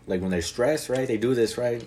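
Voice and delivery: normal talking voice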